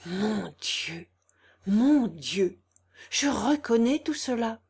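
deeply shaken, understated; overwhelmed stammer. A narrator's reading. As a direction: murmur the first oath, then let emotion rise. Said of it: "balbutiait ... en proie à une émotion terrible".

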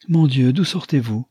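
high, loud surprised cry; jolted awake and caught off guard; startled exclamation. Acted. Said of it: "s'écria-t-elle".

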